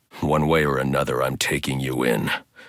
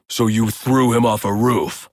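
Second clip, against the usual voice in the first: angry accusation; 8 semitones higher, 4 dB louder than usual. Acted. Interrogating a thug.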